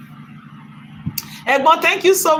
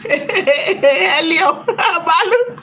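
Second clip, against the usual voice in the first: words breaking into laughter, slow; laughing while talking; 7 semitones higher, much slower than usual. Real, not acted. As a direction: talk through giggles, slow then high.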